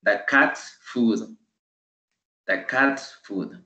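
very slow, clear model read; slow model phrase. Shown as a demonstration. Teacher modeling for class, very slow and clear. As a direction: drill it out slowly for students. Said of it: "Solo le agregué el apóstrofe".

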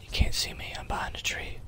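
hushed whisper while hiding. Real, not acted. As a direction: whisper it so nobody hears.

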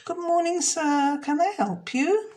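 put-on polite shop girl. Jenny greets the dragon customer, high and bright. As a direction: welcome the customer sweetly. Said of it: "Jenny said".

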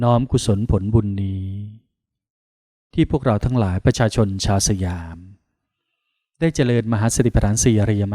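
formal, even-toned merit dedication; solemn prayer of dedication. Acted. Monk dedicating merit to the Thai kings and queen, solemn prayer. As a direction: recite it reverently in measured phrases, pausing after each.

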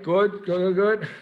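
loud praise. Real, not acted. Praising students mid-drill.

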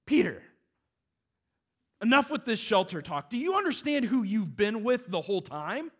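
high, loud, urgent rebuke; God scolding Peter. Retold or imitated. God rebuking Peter for his shelter talk, loud and urgent. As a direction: shake Peter by the shoulders with words. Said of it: "and God's just like".

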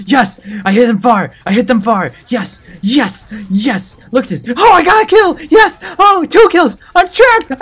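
high, loud excited shouting, climbing to a shriek; thrilled triumph. Real, not acted. Player landing hits and kills, thrilled. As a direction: celebrate each hit, wilder each time.

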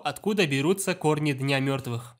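ordinary delivery

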